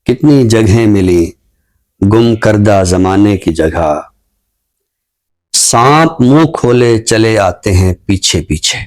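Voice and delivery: slow, measured poetry recital with lingering line ends; ghazal recitation. Acted. Poet reciting his ghazal to the show's audience, slow and measured. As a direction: recite each line slowly, savouring it.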